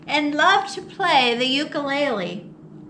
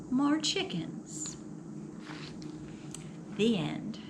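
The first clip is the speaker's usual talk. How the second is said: hushed ending; 2 semitones lower, 13 dB quieter than usual. A narrator's reading. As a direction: let the punchline drop to a whisper. Hushed twist ending of story.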